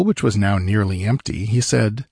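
usual speaking voice

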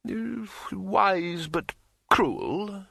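high, very slow, hesitant commiseration; sympathetic arch astronomer. A narrator's reading. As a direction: draw out the sympathy as a halting ruler. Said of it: "said the arch astronomer sympathetically".

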